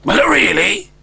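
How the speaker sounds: put-on a young dragon aghast; dismayed question; alarmed dragon boy.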